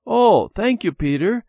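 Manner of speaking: high, loud burst of thanks; thrilled by a new present; delighted thanks. A narrator's reading. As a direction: exclaim it gratefully, pitched high. Child thanks friend for gift.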